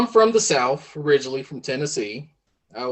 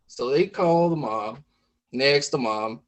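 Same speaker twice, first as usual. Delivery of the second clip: plain reading voice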